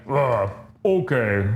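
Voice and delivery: slow, groaning imitation of grudging assent; acted groan, then a drawn-out okay; reluctant giving in. Retold Hulk reluctantly giving in.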